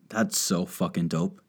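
ordinary talk, nothing marked

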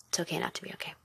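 a hushed, private reminder; breathy whisper of the mantra. Real, not acted. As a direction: whisper it softly to yourself.

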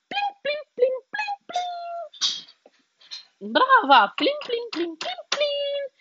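pluck each plin like a string; high plucked plin. A narrator's reading. Narrator imitating the crocodile strumming a bone.

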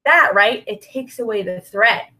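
usual speaking voice

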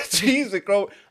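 loud, laughing repeat; laughing mock English; laughing echo. Real, not acted. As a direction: crack up repeating it. Echoing the fake English line.

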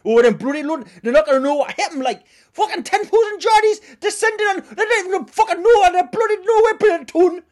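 put-on loud, high, rowdy Geordie accent; Geordie fan. Geordie fan boasting to Londoners in a loud Geordie accent. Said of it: "no one's ever seen anything like it".

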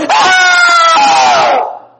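high mock scream of terror; hammed-up yell of fright; frightened scream. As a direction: shriek like a frightened disciple. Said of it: "sino que gritaron".

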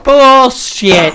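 loud, high scoffing call; scoffing bullshit. Retold or imitated. Scoffing at a coworker's brag, loud and high. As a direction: shout it down as a lie. Second retort, louder.

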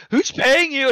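high, loud mock confrontation; mock-outraged questioning of a hired hand; acted-out demand. Acting out the shooter confronting him.

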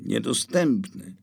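plain reading voice

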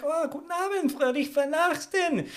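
high, slow German greeting; German accent. Pretend. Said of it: "I decided to speak German".

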